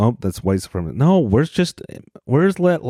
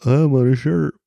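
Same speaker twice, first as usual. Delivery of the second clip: high, goofy dumb-guy voice; mock dim-witted voice on a shirt; dopey voice; 7 semitones higher, slower than usual. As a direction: play it like a proud simpleton. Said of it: "I'm like".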